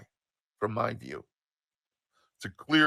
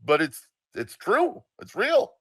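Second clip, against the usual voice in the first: put-on higher, louder naive kid protesting; idiot kid; 7 semitones higher, 5 dB louder, slower than usual. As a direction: plead slowly like a clueless child. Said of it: "like an idiot kid".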